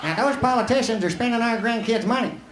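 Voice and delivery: high-pitched, comic politician impression; Ross Perot. Politician lecturing an audience that only half listens, high-pitched impression. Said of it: "You think I look like Ross Perot?".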